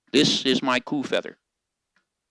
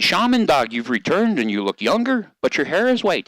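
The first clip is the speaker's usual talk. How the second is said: higher and louder, astonished calls; excited villagers; 9 semitones higher, 4 dB louder than usual. Acted. The people crowding round Shaman Dog, loud surprised calls. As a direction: call out amazed, climbing high.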